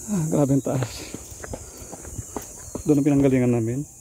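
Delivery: out of breath from the climb; panting between words; hyperventilating. Real, not acted. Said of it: "Hiningal ako, guys".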